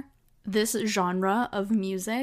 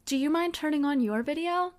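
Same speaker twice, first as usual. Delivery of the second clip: voicing the boss a bit higher, politely asking; 7 semitones higher than usual. Boss asks her to turn on her video, lightly voiced. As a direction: lightly play the boss making a casual request.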